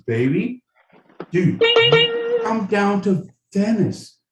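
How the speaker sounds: high, excited dude voice; California dude contestant. Acted. Contestant corrects host's slang then protests being grabbed, high dude voice. California Steve gets it, is removed.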